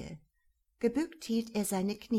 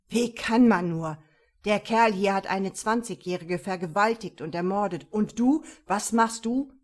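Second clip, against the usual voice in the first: indignant rant; 7 dB louder than usual. Acted. Partner lectures Emil, indignant.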